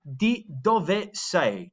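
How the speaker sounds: "Di dove sei?" very slow, the final E in "dove" sounded; slow Italian with clear E. Shown as a demonstration. Teacher modelling for the class, very slow. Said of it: "pronouncing the E there".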